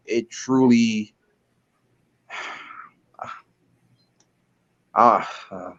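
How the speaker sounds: choked up, trying not to cry; fighting back tears; holding back tears. Real, not acted. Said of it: "I'm trying not to cry".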